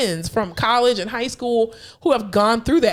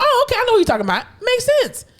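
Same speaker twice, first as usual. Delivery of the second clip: mock realisation; 14 semitones higher, faster than usual. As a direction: act it out brightly, higher. Acted reply in skit.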